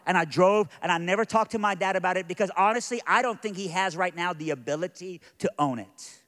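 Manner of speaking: loud, high, driving sermon delivery; preaching at full force. Real, not acted. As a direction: preach it hard to the congregation. Preacher driving point home.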